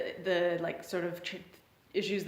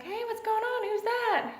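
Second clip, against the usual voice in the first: put-on girl's voice; 14 semitones higher than usual. Girl arrives, voiced by narrator.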